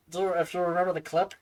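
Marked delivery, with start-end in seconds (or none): none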